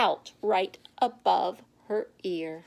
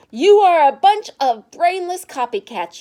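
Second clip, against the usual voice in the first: loud, higher, scolding shout; angry yell; 6 semitones higher, 10 dB louder than usual. Acted. Stephanie yelling at the copycat class, loud and angry. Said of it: "Stephanie yelled".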